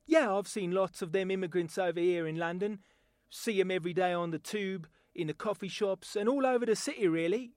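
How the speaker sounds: Cockney London accent; a Londoner chatting about the tube. Shown as a demonstration. A Londoner talking about his city, in a Cockney accent. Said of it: "my voice talent demonstration".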